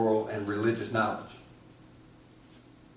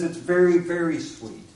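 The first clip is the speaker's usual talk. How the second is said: louder, higher stubborn reply; insistent kid; 6 semitones higher, 6 dB louder than usual. Acted. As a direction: dig in and repeat it, emphatic. Boy insists back.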